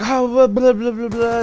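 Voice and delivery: high-pitched shocked blah-blah; put-on wide-eyed babble; mock surprise. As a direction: imitate someone taken aback, pitch jumping up. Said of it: "wide-eyed and got surprised".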